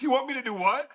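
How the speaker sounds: high, disbelieving question; can't believe the order he just got; incredulous. Retold or imitated. Young soldier balking at the major's order, incredulous. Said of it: "And I'm like".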